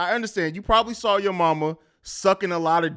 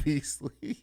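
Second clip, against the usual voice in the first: laughing; 9 dB quieter than usual. Real, not acted. Reads funny name change.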